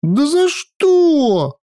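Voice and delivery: put-on high, lively girl voice; sulky teenage granddaughter; young girl voice. A narrator's reading. Sylvia protests.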